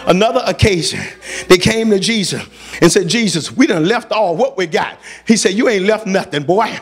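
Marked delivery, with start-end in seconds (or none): none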